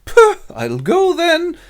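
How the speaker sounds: high, loud, drawn-out huff; huffy. Acted. Fairy queen quarrelling with Oberon, huffy. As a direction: puff and flounce in a high voice.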